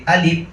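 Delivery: "আলিফ" closed with a p, as "আলিপ"; p at the end. Shown as a demonstration. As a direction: shut the lips on a final p in "আলিফ". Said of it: "শেষে প দিয়ে বলি".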